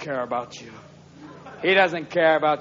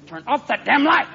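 high, indignant cat voice; hammed-up complaining cat; cat in litter box; 7 semitones higher than usual. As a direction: protest as an annoyed cat, pitched up. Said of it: "your cat's in the cat box going".

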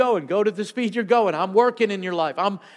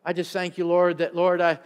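lower, soft, halting prayer; hushed, broken-up worship prayer; quiet prayer; 4 semitones lower, 5 dB quieter than usual. Real, not acted. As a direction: pray quietly and tenderly into the room.

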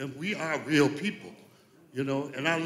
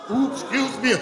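laughing oops; 8 semitones higher than usual. Real, not acted. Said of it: "We're in church".

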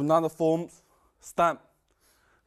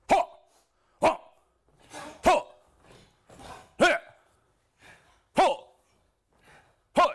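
sharp martial arts shouts; kiai shouts; 3 dB louder than usual. Real, not acted. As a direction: shout each strike from the belly. Kung fu kiai shouts while training.

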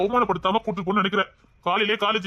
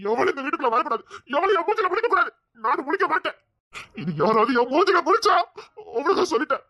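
indignant, pitch jumping up; angry ranting; 4 semitones higher than usual. Acted. Son swears he will face nobody, angry. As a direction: issue heated threats. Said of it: "அநியாயத்துக்கு கத்திட்டேடா".

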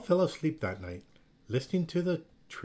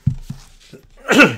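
loud throat clear; 9 semitones higher, 15 dB louder than usual. Real, not acted. Reader clears throat before reading.

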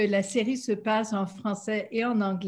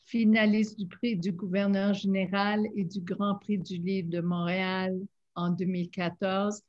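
normal talking voice

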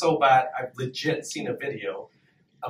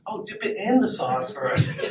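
slow, teasing mock TV chef through a laugh; a light Gordon Ramsay-style cooking tip, slowed down; mock cooking-show host; 6 semitones higher, slower than usual. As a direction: coach the viewers like a celebrity chef, laughing.

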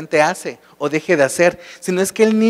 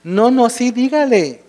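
quick, higher "no, no, sí" urging; insisting mother; 7 semitones higher than usual. Acted. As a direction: push the therapist to try it, mildly excited. Mother urges therapist to try.

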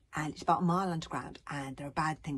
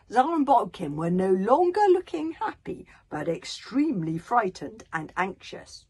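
animated reading, swelling louder; dramatic story narration; 3 semitones higher, 6 dB louder than usual. Acted. Author reads the map discovery aloud, dramatic narration. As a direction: build the alarm for young listeners.

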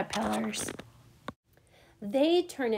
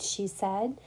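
ordinary delivery

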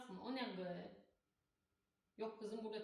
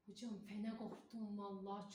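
ordinary delivery

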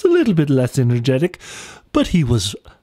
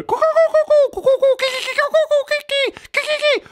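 kookaburra coo; faster than usual. Acted. Cookie coos back at the sheep in a high bird voice. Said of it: "he would coo".

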